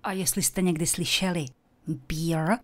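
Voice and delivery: ordinary talk, nothing marked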